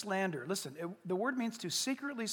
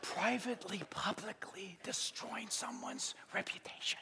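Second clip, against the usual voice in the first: conspiratorial whisper; 2 semitones lower, 10 dB quieter than usual. Acted. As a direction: whisper each word deliberately. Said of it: "if it's being whispered".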